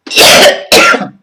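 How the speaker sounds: loud, harsh real cough; hard coughing fit. Real, not acted. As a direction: cough hard, breaking off mid-speech. Ill, coughing fit while talking.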